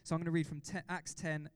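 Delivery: normal talking voice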